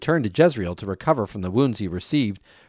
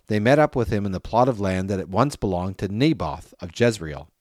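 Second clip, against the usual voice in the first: ordinary delivery, nothing marked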